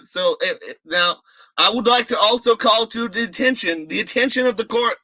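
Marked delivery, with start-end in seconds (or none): 1.6-5.0 high, fast, pompous lawyer voice; mock courtroom orator. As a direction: grandly address the court. Mock courtroom address, high and loud.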